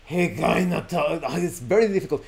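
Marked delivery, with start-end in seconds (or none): 0.1-1.2 terrifying narrator imitation. Shown as a demonstration. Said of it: "let's see if I can imitate it".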